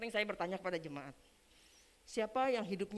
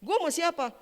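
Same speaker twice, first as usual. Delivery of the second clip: higher, louder repeat of the question; insistent retold question; 10 semitones higher, 10 dB louder than usual. Preacher quoting a relative's question, insistent and loud. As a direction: throw the question out forcefully. Repeats the quote more forcefully.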